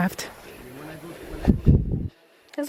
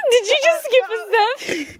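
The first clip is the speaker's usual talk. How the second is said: laughing; 11 semitones higher than usual. Real, not acted. Laughing at friend.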